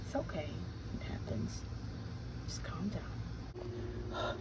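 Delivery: soft, hushed reassurance; calm the child gently; very slow whispered comfort; soothing whisper. Acted.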